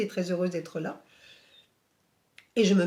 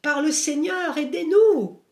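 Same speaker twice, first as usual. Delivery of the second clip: higher and louder, a retold voice in torment begging for help; anguished cry of pain; 8 semitones higher, 5 dB louder than usual. Medium on her knees pleading for the dead, anguished higher cry. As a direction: cry out as a burning soul pleading, pitched high. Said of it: "tombe à genoux en se tordant de douleur".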